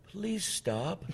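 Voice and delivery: small high sheepish voice. Retold or imitated. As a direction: plead timidly in a soft, high squeak. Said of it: "this sheepish voice".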